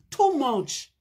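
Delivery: ordinary talk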